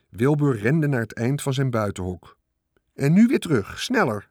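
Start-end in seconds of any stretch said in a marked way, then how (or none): 2.9-4.3 urging order. A narrator's reading. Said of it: "zei Charlotte".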